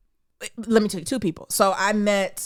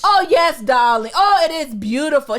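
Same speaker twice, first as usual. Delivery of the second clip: put-on a light take on her Greek accent, grand and gracious; mild Greek-accented darling; Greek accent imitation; 7 semitones higher, 7 dB louder than usual. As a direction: do her accent with lavish charm. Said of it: "she got that accent".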